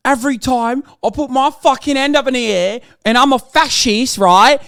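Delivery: British accent, sneering with fake outrage; mock British lad accent. Streamer mocking a far-right Brit in a British accent. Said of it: "Why are you so good with that accent?".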